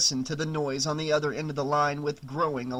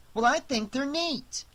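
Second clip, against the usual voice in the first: put-on little boy; 11 semitones higher than usual. Boy defends his comics.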